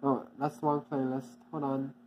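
ordinary delivery

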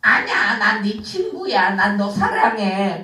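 low, sly villain puppet voice; a low tempter coaxing the child. Acted.

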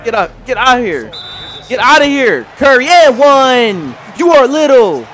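loud, high trash talk at the screen; loud hype trash talk after the block; loud taunt. Real, not acted. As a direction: yell it in his face. Taunting opponent after blocking Curry.

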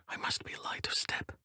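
hushed whisper while sneaking; stealthy whisper. Acted. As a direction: breathe the words, stay unheard. Sneaking, keeping quiet.

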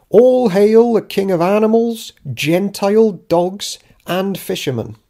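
loud, high sarcastic royal announcement; mocking proclamation. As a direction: hail him like a herald, dripping sarcasm. Mock proclamation about the rabbi.

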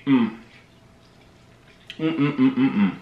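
humming while chewing; chewing pizza. Real, not acted. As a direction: mouth full of food. Seen 0: Pizza piece held at his mouth, biting and chewing throughout.

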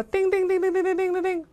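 very high, rapid string of dings; high-pitched bell sound effect; imitated bell ringing. Shown as a demonstration. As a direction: trill like a ringing doorbell. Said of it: "而叮叮叮这个声音".

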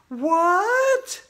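shrieked, very high and loud; Andrea yelling. A narrator's reading. Andrea hearing about monsters, high startled yell. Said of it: "Andrea yelled".

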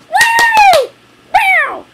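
high, loud cheer; excited whoop. Real, not acted. Host cheering at the end of the episode, loud high whoop. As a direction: whoop it up to close the show.

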